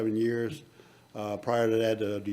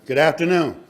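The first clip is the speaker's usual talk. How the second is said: raised, louder hello to the room; louder repeated greeting; 5 semitones higher, 7 dB louder than usual. Real, not acted. Speaker prompting the audience to greet him back, louder and higher. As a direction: call it out higher to get an answer.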